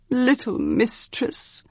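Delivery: put-on slow, soft pleading old servant; a gentle, plaintive appeal. Old servant Manon plaintively appeals to his mistress. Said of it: "Manon said plaintively".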